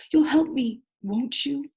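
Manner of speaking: pleading. Acted. Captain pleads with Jim.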